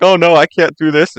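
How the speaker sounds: high, loud mock wail; mock distress. Storyteller voices miller's daughter facing execution, mock-distressed. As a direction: imitate a panicked girl about to cry. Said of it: "And so she's crying".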